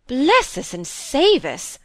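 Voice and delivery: high, loud, drawn-out exclamation; unsentimental astonishment. A narrator's reading. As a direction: exclaim slowly in blunt amazement. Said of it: "with less sentiment in her tone".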